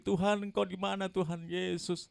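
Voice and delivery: retold shout to God. Patient calling to God when no answer comes, retold shout. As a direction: shout up to God, drawing out the name. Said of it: "saya teriak lagi".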